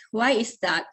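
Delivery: ordinary talk, nothing marked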